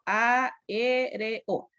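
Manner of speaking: "aéreo" split slowly into a-é-re-o; syllable by syllable. Shown as a demonstration. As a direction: say "aéreo" as four separate, drawn-out syllables. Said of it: "yo la separo".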